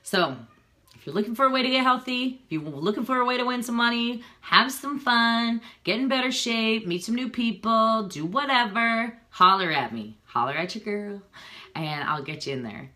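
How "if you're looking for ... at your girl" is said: excited pitch. Real, not acted. Upbeat sales pitch to viewers.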